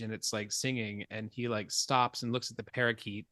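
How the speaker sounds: ordinary talk, nothing marked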